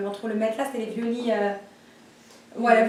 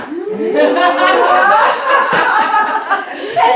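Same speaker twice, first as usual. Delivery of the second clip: big high burst of laughter; real loud laughing fit; 7 semitones higher, 11 dB louder than usual. Real, not acted. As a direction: crack up loudly at the punchline. Laughing at punchline.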